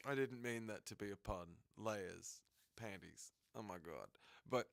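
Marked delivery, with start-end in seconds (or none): none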